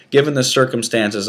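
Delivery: plain reading voice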